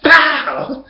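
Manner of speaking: loud high "pow" slap noise; imitated slap sound. Telling the crowd how the slap landed, a loud high pow.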